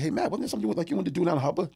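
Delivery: high-pitched imitation of a friend's challenge; calling him out. Chad reminding Matt of his boast about Hubba, imitated calling-out. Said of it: "calling me out".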